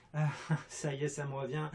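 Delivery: ordinary talk, nothing marked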